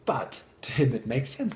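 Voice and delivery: usual speaking voice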